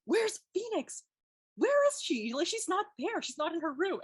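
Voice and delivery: high, frantic worried mother; panicked mom. Retold or imitated. Mother searching the hotel for her missing daughter, high and panicked.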